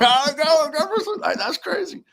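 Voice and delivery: high giddy laugh through the words; laughing disbelief. Retold or imitated. Singer laughing about meeting [name], high and giddy.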